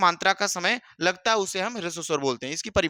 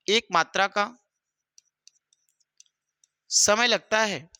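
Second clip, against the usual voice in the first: slow, word by word, with long pauses; slow dictation; slower than usual. Shown as a demonstration. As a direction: dictate it so students can write along. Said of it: "तो ध्यान से लिख लेंगे". (धीमे-धीमे लिखवाते हुए)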